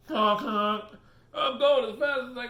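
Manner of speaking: high, loud struggling goose; a goose complaining it's flying as fast as it can. Retold or imitated. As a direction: honk and protest, out of breath. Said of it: "my dad says".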